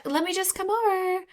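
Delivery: eager squeal. Pretend. Eager reader reply.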